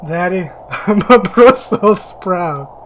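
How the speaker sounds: put-on loud, slow, laughing childish whine; mock the boy's sappy line; hammed-up little boy voice. Mocking the character's line.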